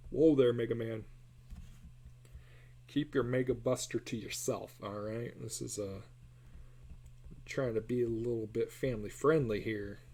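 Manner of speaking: normal talking voice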